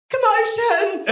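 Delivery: shout. Acted. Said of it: "And I just shout".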